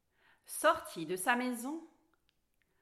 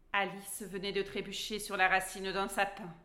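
ordinary delivery

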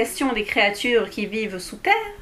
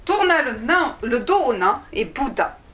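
ordinary delivery

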